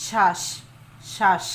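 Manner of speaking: শ্বাস said with a loud, forceful push; teacher hammering the stress on শ্বাস; pressed hard. Shown as a demonstration. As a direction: punch শ্বাস out with weight on it. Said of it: "চাপ দিয়ে জোরে উচ্চারিত হয়েছে".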